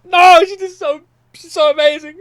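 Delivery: imagined gushing. Retold or imitated. Defending himself to the crowd, loud high gushing. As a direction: gush about the singer. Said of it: "I'd be like".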